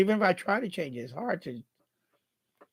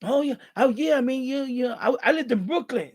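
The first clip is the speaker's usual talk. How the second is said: glad fellow New Yorker; 6 semitones higher than usual. Retold or imitated. Retold fellow New Yorker glad to meet him.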